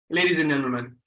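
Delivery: normal talking voice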